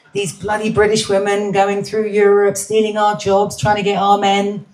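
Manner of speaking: a hint of put-on indignation; mock resentful local. Voicing locals resenting British women.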